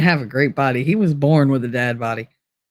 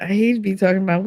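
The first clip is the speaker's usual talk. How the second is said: ordinary talk, nothing marked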